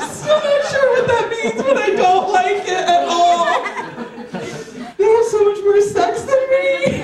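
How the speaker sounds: high, hammed-up whine; mock distressed wail. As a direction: wail it high in comic despair. Mock distress about parents' sex life.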